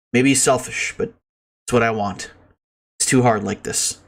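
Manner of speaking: subdued, downcast diary reading. Acted. Reads a sad diary aloud.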